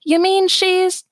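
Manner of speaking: high, rising disbelief; confused surprise. Acted. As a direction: squeak out the question. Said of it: "Columbia, confused, turns to Magenta and says".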